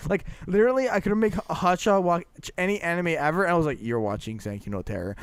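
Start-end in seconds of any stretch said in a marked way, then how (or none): 3.8-5.2 low, quiet, firm decree; retold ultimatum, dropped low and soft; mock stern order. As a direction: lay down the law in a low voice. Retells laying down the bet's penalty.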